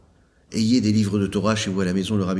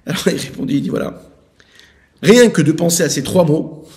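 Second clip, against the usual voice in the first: fervent preaching; 7 semitones higher, 8 dB louder than usual. Acted. Rabbi preaches on the three words, fervent. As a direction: preach it with full force.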